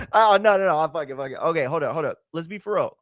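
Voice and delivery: ordinary delivery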